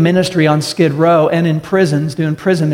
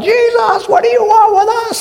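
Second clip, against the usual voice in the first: high, shrill-pitched demon cry, much higher; shrieking demons. Acted. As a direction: screech it like possessed men terrified of Jesus.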